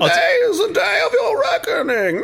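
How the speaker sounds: high, loud theatrical villain shout. Retold or imitated. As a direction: ham up a grand threat. Mock villain proclamation.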